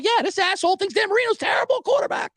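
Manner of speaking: high, shouted complaint to security; angry shout. Retold or imitated. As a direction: shout it out, pointing at the guy. Yelling to security about fan.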